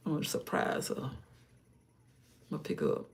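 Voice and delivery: hushed, secret plan; conspiratorial whisper. Real, not acted. Secret plan to surprise granddaughter.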